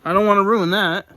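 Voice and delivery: suddenly worried about the bookmark; startled, protective of the bookmark; alarmed. Real, not acted. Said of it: "Oh my gosh".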